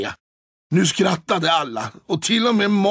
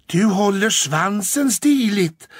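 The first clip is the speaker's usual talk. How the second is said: shy; 5 semitones higher, 4 dB louder, slower than usual. A narrator's reading. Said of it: "sa Gullan blygt".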